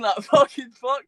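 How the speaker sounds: a stunned laugh at the memory; laughing disbelief. Retold or imitated. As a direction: laugh through the repeated fucking fuck, drawn out. Repeats it, laughing, slower.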